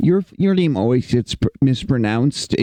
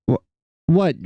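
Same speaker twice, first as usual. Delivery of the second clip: ordinary delivery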